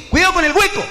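high warning shout. Acted. Teller warning a blind man about the hole, high shout. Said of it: "le grito".

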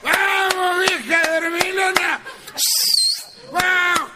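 mock street shout. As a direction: yell up at the house at four in the morning. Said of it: "a lo grito".

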